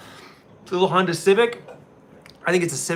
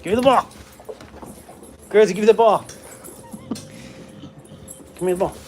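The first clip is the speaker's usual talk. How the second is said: playful demand; 3 semitones higher, faster than usual. Real, not acted. Man playing fetch with his dog, playful demand. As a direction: demand it in play, voice jumping up.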